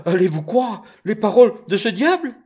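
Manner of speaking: high, loud frantic protest; panicked mother. A narrator's reading. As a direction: plead in alarm. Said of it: "dit la mère affolée".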